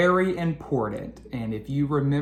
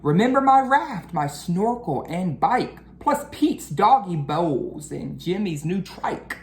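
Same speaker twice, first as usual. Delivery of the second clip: lively storybook read-aloud; reading to children; 3 semitones higher than usual. A narrator's reading. Teacher reading a picture book to her class, lively storytelling. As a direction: read the rhyming story to the kids.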